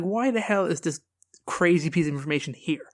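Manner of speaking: ordinary talk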